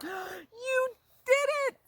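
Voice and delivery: high, loud, slow praise; proud excitement. Acted. Dad praising Jabari after the flight, high loud proud. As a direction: burst with pride for your son.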